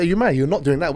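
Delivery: replaying a heated row, half-acted; mock-angry husband pushing back; retold argument. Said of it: "We had a big argument".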